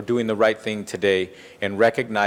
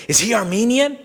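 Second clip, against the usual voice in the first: put-on high, gleeful child's question; little girl's high gleeful question to the councilman; 12 semitones higher, 4 dB louder than usual. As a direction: ask it like an excited nine-year-old. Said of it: "looked at me very gleefully and said".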